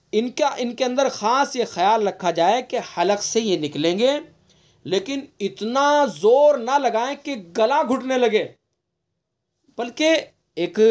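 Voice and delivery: normal talking voice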